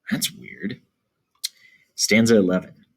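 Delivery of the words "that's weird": soft whispered comment; a hushed aside to the class. Real, not acted. Aside to class after paraphrase.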